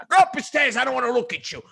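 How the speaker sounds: high, loud scolding order; father ordering the kid away, high and loud; angry father. Retold or imitated. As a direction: bark him up the stairs. Father sending son upstairs.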